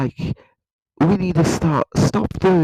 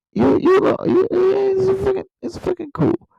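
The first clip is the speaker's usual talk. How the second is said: excited squeal. Real, not acted. Gushing about Chinese accent.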